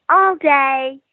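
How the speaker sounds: put-on the child ghost calling out; little girl ghost. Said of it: "all you could hear clearly from her was".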